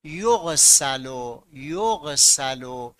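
یغسل said very slowly, yughsalu; slow passive form. Shown as a demonstration. Teacher to students, slowly modeling the passive verb. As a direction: spell out یغسل syllable by syllable, yugh-sa-lu. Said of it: "یعنی باید بشود".

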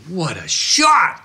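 high, slow, drawn-out cry of delight; thrilled filmmaker. Retold or imitated. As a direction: relive the thrill of the perfect shot. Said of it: "I'm going".

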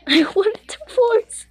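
high-pitched, breaking into laughter; laughing mock complaint. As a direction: squeak it out through giggles. Joking while laughing at the game.

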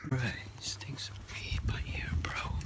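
whispered slowly, no voice; slow whisper, mind the neighbours. Real, not acted. Passing weed smell on street.

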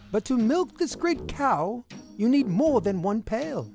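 plain reading voice

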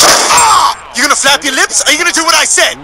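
shrieking threat; bully him into obeying; high angry yell. Acted. Threatening, yelling at Brian.

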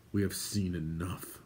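soft, grave command; quiet order. A narrator's reading. Gwydion withdrawing from the horror, quietly ordering. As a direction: order it in a low volume. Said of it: "Gwydion ordered".

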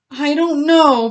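high, drawn-out little whine; whining. A narrator's reading. Little pig Bully unsure of his new home, whining. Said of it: "Bully whined".

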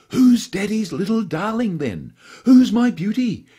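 put-on high, loud cooing baby talk; doting baby talk. A narrator's reading. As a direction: gush over the little dog like a doting owner. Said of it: "he whispered to the hidden Pomeranian".

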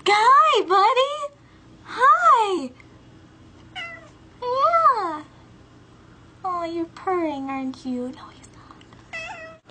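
high cooing pet voice. Real, not acted. Cooing to her cat.

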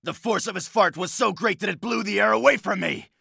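very high, loud shrieking; shrill exclamation. Acted. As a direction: shriek it in comic panic. Comic exclaimed fart line.

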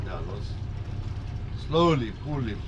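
ordinary talk

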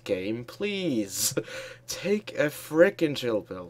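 ordinary talk, nothing marked

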